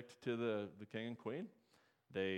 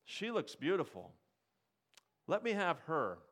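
higher and louder, driving the point home; fiery preaching; 6 semitones higher, 5 dB louder than usual. Acted. Preacher retelling David and Bathsheba to his congregation, fired up. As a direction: preach it with rising force.

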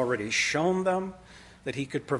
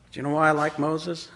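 usual speaking voice

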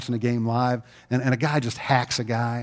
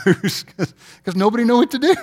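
laughing; 8 semitones higher, 6 dB louder than usual. Real, not acted. Laughing at the bedlam.

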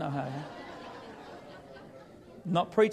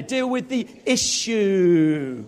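slow, loud, punched out with attitude; preacher demanding attitude from the crowd; 2 semitones higher, 8 dB louder, much slower than usual. Shown as a demonstration. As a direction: say it like you mean business. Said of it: "you've got to say it with attitude".

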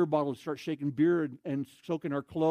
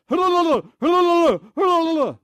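very high, loud rapid wail; imitated hysterical high-pitched yelling; frantic cry; 13 semitones higher, 15 dB louder than usual. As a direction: scream it desperately in a garbled rush. Man clutching his leg, crying out.

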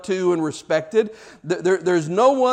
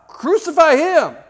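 eager echo; 10 semitones higher, 6 dB louder than usual. Retold or imitated. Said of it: "Barabbas is standing there thinking".

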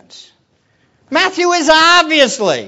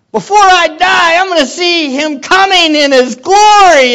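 higher and louder, shouting with joy, near tears; overjoyed disciple; 7 semitones higher, 6 dB louder, slower than usual. Acted. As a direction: shout it like the best news of your life. Disciple overjoyed at coming glory.

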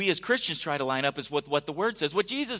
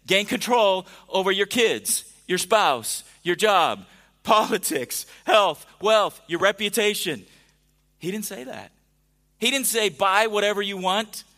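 louder, building preacher's list of worldly goals; rising sermon list; 4 dB louder, slower than usual. Acted. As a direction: pile up the list like a preacher gathering steam.

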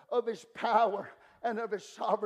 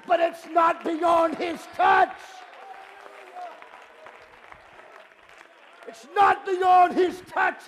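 high, loud preacher's shout with stretched climaxes; impassioned revival cry, swelling to the last line; full-force preaching; 6 semitones higher, 6 dB louder than usual. Acted. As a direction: declare it defiantly to the rafters.

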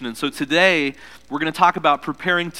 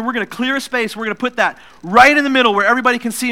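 excited mom; 6 semitones higher, 5 dB louder than usual. Retold or imitated. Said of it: "Mom says".

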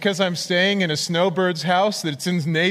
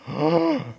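imitated high gasp of alarm; scared gasp; 11 semitones higher than usual. Missionary startled by a commitment, imitated scared gasp. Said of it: "he was really scared".

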